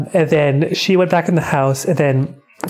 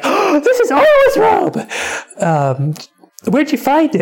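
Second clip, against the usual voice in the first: mock shock; 12 semitones higher than usual. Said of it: "And Osode's like".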